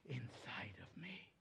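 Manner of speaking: sudden hushed whisper. Acted. Ending the prayer for the congregation in a whisper. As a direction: drop to a breathless whisper. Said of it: "You are desperate for the Holy Spirit".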